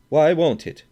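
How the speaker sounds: loud, high challenge; angry demand. A narrator's reading. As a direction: throw it back hard. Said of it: "demanded the Swede".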